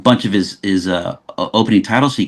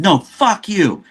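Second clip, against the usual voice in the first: much higher, drawn-out incredulous swearing; emphatic retold disbelief; 11 semitones higher, slower than usual. Director refusing to believe the filmmaker's claim, emphatic retold disbelief. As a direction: stretch out the imitated disbelief, pitch soaring.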